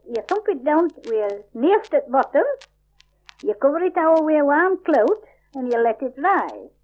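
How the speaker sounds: ordinary delivery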